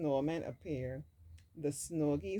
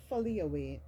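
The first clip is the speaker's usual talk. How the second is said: ordinary delivery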